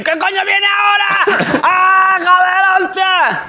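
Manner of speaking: high, frantic inner panic; panicked inner voice. Pretend. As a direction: squeal in inner terror. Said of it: "por dentro estoy". (pánico interior agudo, soltando tacos)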